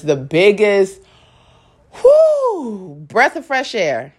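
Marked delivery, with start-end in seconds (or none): 1.9-3.0 high, drawn-out "whew"; relieved whew. Real, not acted. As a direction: let out a big high whoop of relief. Relief at leaving the situation.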